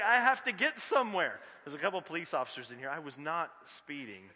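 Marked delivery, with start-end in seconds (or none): 0.0-1.4 frustrated. Retold or imitated. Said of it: "And you're like".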